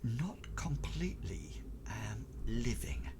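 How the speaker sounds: secretive whisper with long, searching pauses; Tobias. Acted. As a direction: whisper haltingly so others can't overhear. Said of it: "he doesn't want them to hear".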